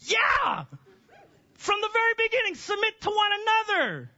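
a triumphant high shout; emphatic yell. Real, not acted. Preacher answers the squirming men with a jubilant shout. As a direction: fire back a ringing, jubilant answer.

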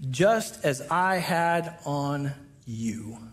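very slow, low emphasis; hushed and heavy final line; crucial point. Real, not acted. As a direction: land it slowly, every word weighted. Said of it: "this is crucial, the big idea".

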